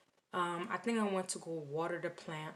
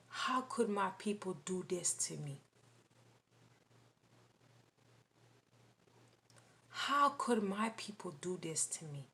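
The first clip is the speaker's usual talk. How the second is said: hushed whisper of a grieving voice; whispered quote; 3 dB quieter than usual. Retold or imitated. Retelling the frustrated voice from the sky, whispered. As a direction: breathe the words in a whisper. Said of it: "the voice sounded so frustrated".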